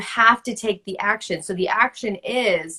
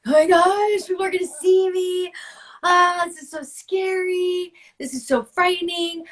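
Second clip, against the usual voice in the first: high, breathless mock panic, much higher pitch; panicked inner voice; 8 semitones higher than usual. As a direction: squeak it out like a terrified newbie at dance class. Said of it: "I'm, like, freaking out".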